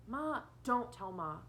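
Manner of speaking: put-on anxious boy begging his sister; urgent plea. A narrator's reading. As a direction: beg her not to tell, drawn out. Chuck pleading with sister.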